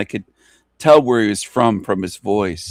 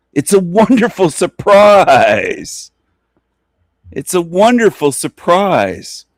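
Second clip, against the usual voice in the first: slow, drawn-out gloating, much higher and louder; taunting sing-song; 8 semitones higher, 5 dB louder, slower than usual. Real, not acted. Gloating at the demons, slow and sing-song. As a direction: savor each word like a teasing secret.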